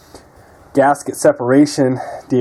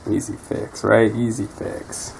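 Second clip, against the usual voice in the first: sarcastic; 3 semitones lower, 7 dB quieter than usual. Real, not acted. Sarcastic after seeing damage.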